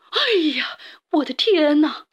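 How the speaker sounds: high, frightened yelp; startled old woman's cry. A narrator's reading. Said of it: "瘦小的老妇人喊起来".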